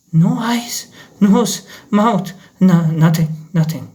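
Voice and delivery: gasping, broken terror; terrified. Acted. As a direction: choke out the words in fear.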